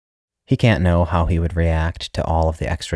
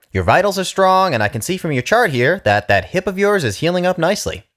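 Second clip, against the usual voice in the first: much higher, louder upbeat doctor; young doctor; 13 semitones higher, 3 dB louder than usual. Acted. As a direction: pitch it up, brisk and cheery. Young doctor voiced high.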